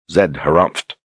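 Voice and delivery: usual speaking voice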